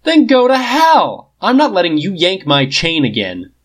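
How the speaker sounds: high loud slow yell; furious outburst at Fury; furious shout. A narrator's reading. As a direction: hurl it at him.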